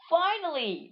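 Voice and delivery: high, loud cartoon exclamation; high exasperated-style outburst; [name]'s voice. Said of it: "responds [name]".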